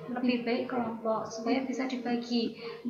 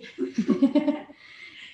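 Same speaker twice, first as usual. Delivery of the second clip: breathy laugh; 2 semitones higher, 7 dB louder than usual. Real, not acted. Teacher laughs at question.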